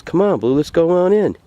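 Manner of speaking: high-pitched, encouraging call to a dog; acted coaxing, pitched well up. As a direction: urge a stubborn dog along. Man coaxes balking dog on leash.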